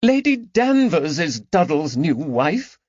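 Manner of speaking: high, rising distress; the news cuts her. Acted. Lady Caroline telling her friends, rising in distress. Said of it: "voice rose with distress".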